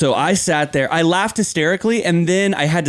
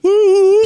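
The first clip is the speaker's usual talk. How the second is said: much higher, louder hooting whoop; mock whoop; 6 dB louder than usual. The narrator imitates the driver whooping at him, much higher and louder. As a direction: let out a goofy whoop. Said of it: "like that".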